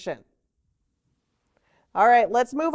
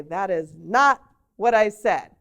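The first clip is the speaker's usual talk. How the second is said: slow, louder, each word weighed; firm correction; 3 semitones lower, 4 dB louder than usual. Retold or imitated. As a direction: put a teacher's gentle finality on it. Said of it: "And I say".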